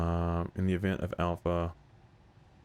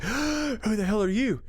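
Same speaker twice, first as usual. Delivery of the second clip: startled shout; 5 dB louder, much slower than usual. Retold or imitated.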